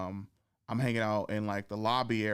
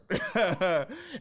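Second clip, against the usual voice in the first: laughing; 7 semitones higher than usual. Real, not acted. Laughing at own story.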